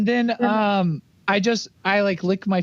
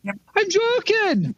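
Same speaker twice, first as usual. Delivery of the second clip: character voice; 9 semitones higher than usual. Acted. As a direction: stretch it out, pitched up high. Player voicing rat character.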